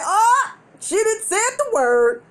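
high, drawn-out scandalized reaction; mock shock. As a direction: gasp it out like the taboo was broken. Reacting to forbidden word in story.

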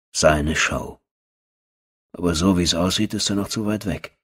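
ordinary delivery, nothing marked

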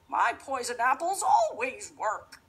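witch voice. Acted. Witch baffled.

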